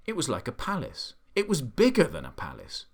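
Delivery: ordinary delivery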